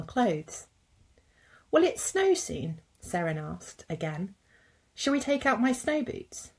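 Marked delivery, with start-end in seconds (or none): 1.7-2.8 put-on high, slow little-girl voice; slow high child voice full of hope; hopeful little Seren. A narrator's reading. Child character Seren asks. 4.9-6.5 put-on high, eager little-girl voice; high child voice full of hope; little Seren. A narrator's reading. Child character Seren asks.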